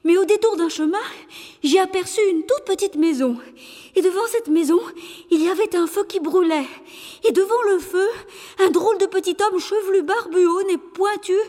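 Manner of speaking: high, hurried messenger voice; breathless messenger. Acted. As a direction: report in a rush, out of breath. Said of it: "tout essoufflé".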